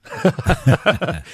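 short high laugh. Real, not acted. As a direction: burst of laughter. Laughs about [name] stoned.

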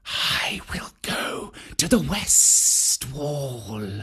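slow snake whisper. A narrator's reading. Kaa the python plotting with Bagheera, slow whisper. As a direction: breathe it out like a snake. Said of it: "Kaa whispered".

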